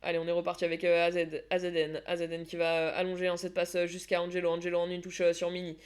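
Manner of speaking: steady running match commentary; play-by-play commentary. Acted. Commentating her team's match for the stream. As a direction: call the passes as they happen.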